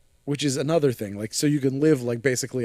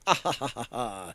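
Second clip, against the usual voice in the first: imitated villain laugh; 6 dB quieter than usual. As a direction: act out a gloating laugh, each ha separate. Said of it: "Trickster's sitting in the principal's desk, laughing".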